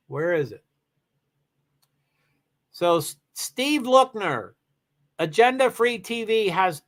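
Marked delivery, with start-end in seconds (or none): none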